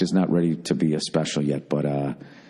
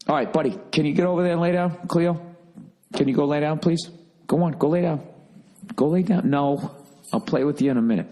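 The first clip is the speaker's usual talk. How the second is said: commanding the dog; 7 semitones higher than usual. Real, not acted. Telling dog to lie down.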